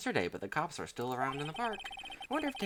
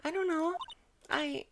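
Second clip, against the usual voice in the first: put-on high girlish voice; earnest young assistant asking; lifted pitch, teen girl; Maya voice. Voicing Maya in game dialogue.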